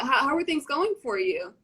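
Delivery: normal talking voice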